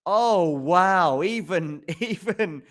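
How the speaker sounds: high, awed, breaking into a laugh; amazed laugh. Real, not acted. Commentator still buzzing after the passing shot, amazed laugh. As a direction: let the wonder spill into laughter.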